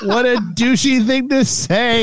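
laughing. Real, not acted. Reacting to crude message.

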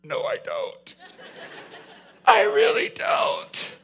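voiceless, embarrassed little protest; sheepish breathy denial. Acted. Peter embarrassed before the mocking crews, breathy denial. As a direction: deny it under your breath, ashamed.

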